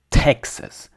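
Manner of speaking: TEX punched in Texas, schwa after it; first-syllable stress. Shown as a demonstration. Teacher shows English word stress, first-syllable stress on Texas. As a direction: Texas as TEK-sus, strong start. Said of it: "the emphasized vowel is the first one".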